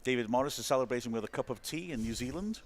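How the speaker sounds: usual speaking voice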